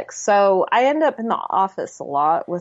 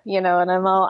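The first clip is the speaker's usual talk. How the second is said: ordinary talk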